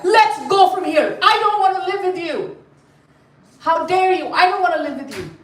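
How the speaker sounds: high-pitched acted indignant outburst; upset wife. Wife leaving home with the children, acted upset. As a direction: fling it out in theatrical outrage. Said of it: "Lakshmi got upset".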